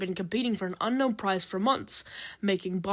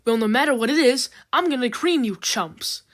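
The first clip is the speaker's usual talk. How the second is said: bold, loud brag to rivals; loud blurt; 4 semitones higher, 6 dB louder than usual. A narrator's reading. Drill bragging to the other contestants, loud blurt. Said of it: "Drill blurts out".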